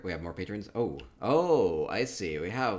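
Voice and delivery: ordinary talk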